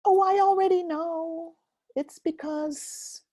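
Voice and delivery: high, dismissive know-it-all voice; smug little "oh, I already know". Pretend. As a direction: brush it off as if you already know. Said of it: "by thinking".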